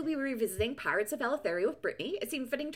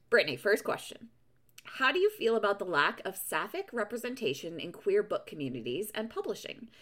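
ordinary delivery

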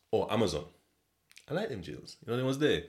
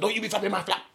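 mock yell; 10 semitones higher, faster than usual. Yelling out window at him.

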